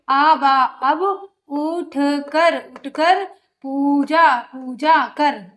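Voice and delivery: very slow, piece by piece, उठ कर पूजा कर split into chunks; broken reading. Shown as a demonstration. Teacher showing pupils how to read in pieces, very slow. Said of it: "पहले आप तो तोड़ के पढ़ो".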